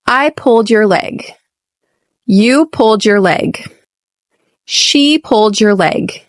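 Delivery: "pulled" stressed, "your" swallowed; stress on the verb. Shown as a demonstration. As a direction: hit "pulled" and glide over "your leg". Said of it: "the emphasis is on the second word, which is the verb".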